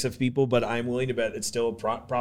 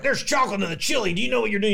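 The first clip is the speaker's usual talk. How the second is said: a hint of a Southern local, mildly shocked; 7 semitones higher than usual. Cincinnati locals scoffing at chocolate in chili, light Southern voice. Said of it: "I'm doing a Southern voice".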